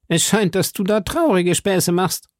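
high-pitched blustering musketeer; Porthos. Acted. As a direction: pitch it well up, mildly reproachful. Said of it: "sagte Porthos". (hohe, polternde Musketierstimme)

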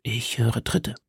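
quiet warning. Acted. Felton warnt flüsternd.

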